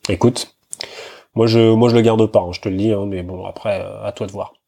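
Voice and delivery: usual speaking voice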